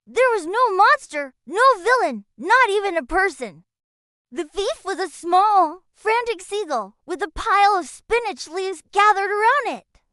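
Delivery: usual speaking voice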